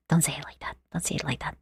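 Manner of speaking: a shy, fading whisper; embarrassed whisper. Real, not acted. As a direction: whisper it, flustered.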